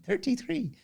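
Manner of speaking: put-on a Taiwanese child doing an Irish accent, high. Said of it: "an impression of a Taiwanese child ... doing an Irish accent".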